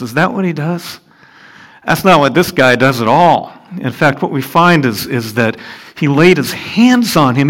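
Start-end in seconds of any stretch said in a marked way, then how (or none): none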